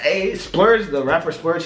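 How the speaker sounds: ordinary talk, nothing marked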